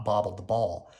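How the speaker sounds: plain reading voice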